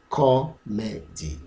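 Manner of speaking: "Comedy" in three slow, separate beats; syllable by syllable. Shown as a demonstration. Teacher showing learners three syllables, spaced out slowly. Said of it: "has three syllables".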